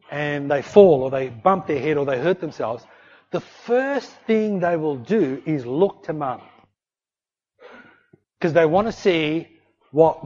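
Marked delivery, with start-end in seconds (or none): none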